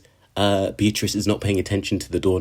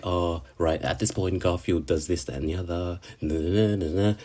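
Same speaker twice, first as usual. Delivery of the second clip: musing to himself; 7 dB quieter than usual. Retold or imitated. A fan voices Subaru thinking through past routes, quiet musing. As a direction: think aloud quietly, tailing into nonsense syllables.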